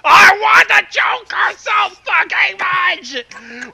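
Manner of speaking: slow, loud shouted craving; comic outburst. Real, not acted. Reader yelling at friends mid-story, slow loud shout. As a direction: bellow it out, dragging each word. Outburst while reading a horror scene.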